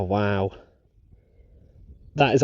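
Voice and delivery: usual speaking voice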